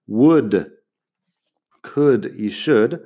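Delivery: Would, could, should with silent L and short U; no L sound. Shown as a demonstration. Teacher to Spanish learners, would could should without the L. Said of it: "No lo pronuncies".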